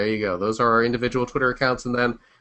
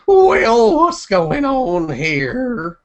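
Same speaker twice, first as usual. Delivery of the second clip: put-on old prospector; 11 semitones higher, 6 dB louder, much slower than usual. Continues the prospector bit.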